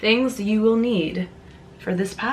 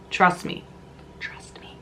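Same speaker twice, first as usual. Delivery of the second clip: soft conspiratorial whisper; 2 semitones lower, 8 dB quieter than usual. Shown as a demonstration. Whispering a tip to viewers, softly. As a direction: lean in and whisper it. Repeated trust me fading to whisper.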